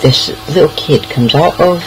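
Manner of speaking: usual speaking voice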